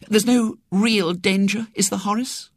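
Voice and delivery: high, slowed anxious appeal; worried young woman. A narrator's reading. Young woman asking her doctor friend about danger, worried.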